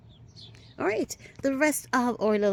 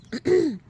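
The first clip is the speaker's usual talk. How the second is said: throat clear; 9 semitones higher, 8 dB louder than usual. Real, not acted. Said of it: "excuse me, losing my voice there".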